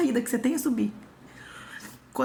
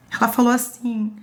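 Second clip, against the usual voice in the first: normal talking voice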